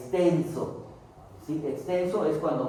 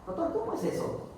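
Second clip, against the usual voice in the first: whispered student question; 11 semitones higher, 5 dB quieter than usual. Acted. Student asks the doctor.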